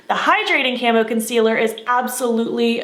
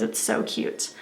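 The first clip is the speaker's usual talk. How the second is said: whispering; 6 semitones lower, 10 dB quieter, slower than usual. Real, not acted. Whispered aside about the pencils.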